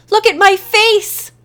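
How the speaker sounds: high, loud dismayed cry; distressed. A narrator's reading. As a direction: exclaim in dismay, pitched well up. Carrie shows her blotched face.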